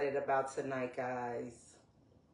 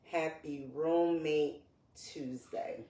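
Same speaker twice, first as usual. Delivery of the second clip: ordinary talk, nothing marked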